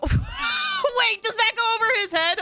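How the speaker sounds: shrieking high disbelief; laughing, high-pitched shock; surprised. Real, not acted.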